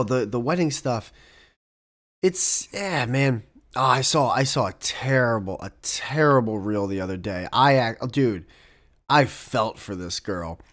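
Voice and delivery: usual speaking voice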